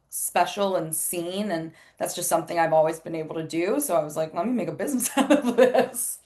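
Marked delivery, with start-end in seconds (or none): 4.3-6.2 quick, laughing self-quote, higher. Retold or imitated. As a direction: toss it off with a laugh. Her own thought, laughing.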